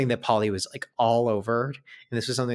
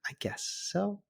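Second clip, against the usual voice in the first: whispered, hesitant agreement; scared man giving in, whispering; timid whisper; 11 semitones higher, 8 dB quieter than usual. Retold or imitated. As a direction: breathe it out nervously. Timid reply on the tire.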